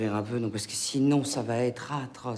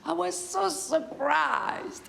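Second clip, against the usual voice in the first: much higher, slow gushing surprise; mock surprise; much slower than usual. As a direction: gush it out, very high and slow. Aunt gushing in English.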